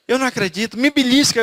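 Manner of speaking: stunned disbelief. Retold or imitated. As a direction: play the overwhelmed wife pleading to be pinched. Wife crying, can't believe it.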